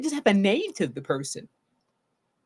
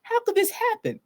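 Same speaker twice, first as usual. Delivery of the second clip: confused disbelief; 11 semitones higher than usual. Shown as a demonstration. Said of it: "a loss, a confusion of".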